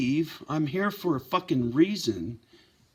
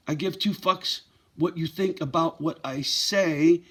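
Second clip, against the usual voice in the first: normal talking voice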